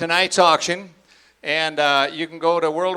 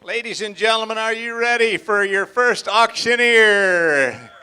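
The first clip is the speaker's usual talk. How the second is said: announcer voice; 4 semitones higher than usual. Real, not acted. Introducing the first auctioneer.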